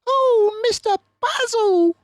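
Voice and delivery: high, loud wailing cry; a high, loud, upset housekeeper's wail. A narrator's reading. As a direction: wail his name in distress. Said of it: "she wailed".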